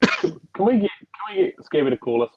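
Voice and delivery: usual speaking voice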